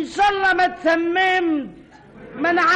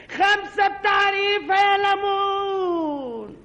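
street vendor call; 3 semitones higher, slower than usual. Retold or imitated. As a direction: sell lemons at the top of your lungs. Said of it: "بتنادي على الليمون".

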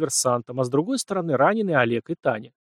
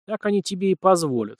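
higher-pitched sceptical retort; dry, raised-pitch scoff; wry snort; 6 semitones higher than usual. A narrator's reading. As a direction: mildly scoff at the idea. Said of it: "хмыкнул Март".

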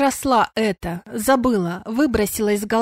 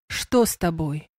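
insistent; 5 semitones lower than usual. A narrator's reading. Sandr repeats his question to the unwell woman, insistent. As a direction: press gently for an answer, voice lowered. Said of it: "настойчиво повторил он".

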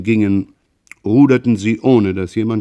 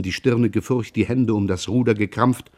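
usual speaking voice

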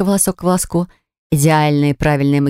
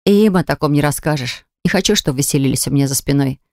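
plain reading voice

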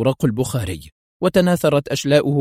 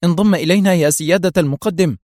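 higher, urgent call in fear; alarmed cry; 6 semitones higher, 4 dB louder than usual. A narrator's reading. Lieutenant calling her superior to join them, alarmed. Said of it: "صاحت منى بفزع".

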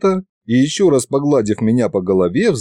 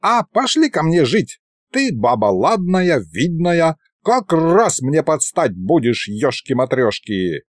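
put-on higher, cocky old wizard wooing; courting old wizard; 4 semitones higher than usual. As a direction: chat up the aunt like a boastful old sorcerer. (старый колдун заигрывает, выше обычного)

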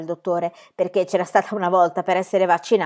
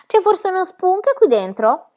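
small, much higher, slow voice; little voice; 12 semitones higher, 6 dB louder, slower than usual. A narrator's reading. Pippi peeks in the window, tiny high voice. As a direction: pipe it up like a tiny voice. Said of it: "udirono una vocetta domandare".

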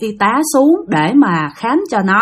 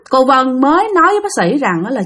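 ordinary delivery, nothing marked

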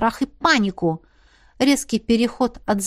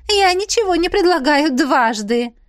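haughty snap; 7 semitones higher, 4 dB louder than usual. Acted. Princess snaps angrily.

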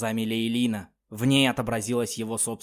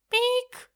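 shrill synthetic ping; high electronic beep. Acted. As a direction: beep like a machine. Implant beep sound.

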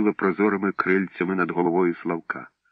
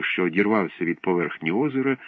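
usual speaking voice

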